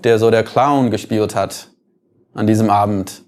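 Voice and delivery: ordinary talk, nothing marked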